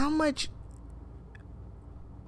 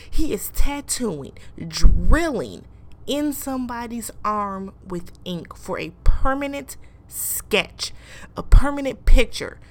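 exasperated rant; 3 semitones higher, 8 dB louder than usual. Real, not acted. Ranting about a permanent tattoo.

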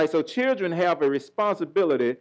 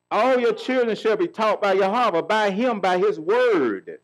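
full preaching; 4 semitones higher than usual. Acted. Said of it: "preaching the verse".

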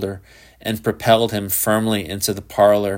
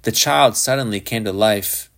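plain reading voice

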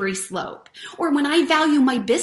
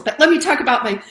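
normal talking voice

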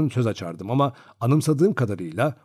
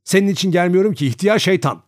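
higher, louder angry yell; boy yelling at the old colonel, higher and louder; angry child's shout; 7 semitones higher, 6 dB louder than usual. A narrator's reading. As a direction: shout it back at the old man. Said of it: "diye bağırdım".

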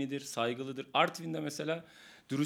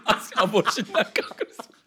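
loud, laughing story; 5 semitones higher, 8 dB louder than usual. Real, not acted. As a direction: laugh through the line. Laughing at his own story.

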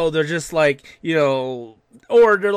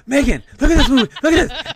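put-on excited teen girl; 9 semitones higher, 6 dB louder, faster than usual. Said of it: "It's like".

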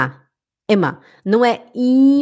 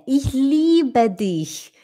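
slow example; much slower than usual. Shown as a demonstration. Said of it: "example read slowly".

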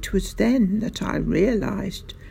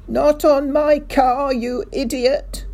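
bellowing; 7 semitones higher, 5 dB louder than usual. A narrator's reading. Mister Hazel rages at the sergeant, bellowing. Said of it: "Mister Hazel bellowed".

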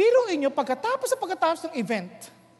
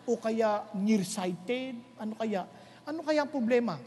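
muttered wondering; 7 semitones lower, 7 dB quieter than usual. Retold or imitated. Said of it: "Sabi ko".

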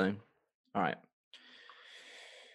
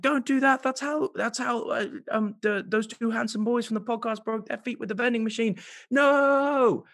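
much higher panicked warning; panicked Monica; 9 semitones higher than usual. Retold or imitated. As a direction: shriek at her to stop. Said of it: "Monica's like".

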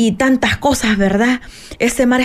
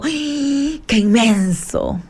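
slow, marvelling ay; awed sigh; 3 semitones higher, much slower than usual. Retold or imitated. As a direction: linger on the vastness in awe. Marvelling at the vast sea.

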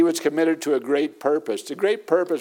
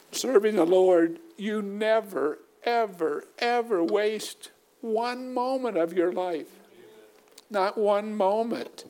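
hushed fervent preaching; 7 semitones higher, slower than usual. Acted. Soft high slow preaching.

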